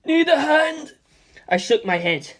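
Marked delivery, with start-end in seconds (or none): 0.0-1.0 Stephen's voice. Acted.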